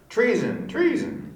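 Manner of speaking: high alarmed cry; shouting treason. Acted. Lords cry out in the play.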